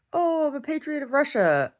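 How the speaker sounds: high, sing-song canned answer; canned patriot answer. Retold or imitated. Mocking Russians on the street, high canned patriot reply. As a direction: parrot the official line in a high voice. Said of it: "the canned answer".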